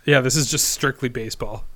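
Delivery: ordinary talk, nothing marked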